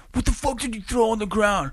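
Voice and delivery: put-on cocky teen confronting a stranger; high mock angry yell. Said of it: "I was like".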